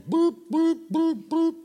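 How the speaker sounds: high-pitched robotic boops; evenly spaced high beeps of a stumped brain; baffled mind beeping. Acted. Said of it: "your mind is going".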